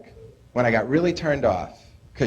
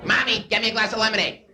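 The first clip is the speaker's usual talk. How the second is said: put-on higher, whiny spoiled kid demanding; 8 semitones higher than usual. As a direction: play the bratty only child ordering mom around. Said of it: "that spoiled kid voice".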